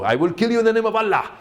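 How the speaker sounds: high, fast, hammed-up villain shout; menacing terrorist role. Actor mocking the terrorist parts he got offered, hammed-up and fast. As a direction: bark it like a cartoon movie terrorist. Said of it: "Those were the parts".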